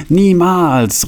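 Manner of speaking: high, sustained call of refusal; defiant cry. A narrator's reading. Franz refuses to accept their fate, calling out defiantly. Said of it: "rief Franz".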